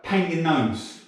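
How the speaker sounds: slow, high, proudly announced; proper chuffed. Retold or imitated. Prison officer revealing the gnome job, mock chuffed. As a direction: imitate a chuffed officer, drawn out. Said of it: "she's all proper chuffed".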